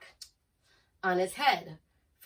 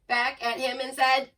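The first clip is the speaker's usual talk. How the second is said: plain reading voice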